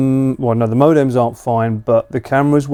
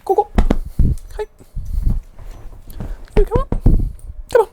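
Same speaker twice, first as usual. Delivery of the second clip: high, eager call to the dog; much faster than usual. Real, not acted. Owner calling a friend's dog to come over, bright and eager.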